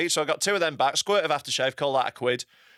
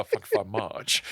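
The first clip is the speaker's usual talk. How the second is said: [name] impression; 6 semitones lower than usual. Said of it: "I'll just go".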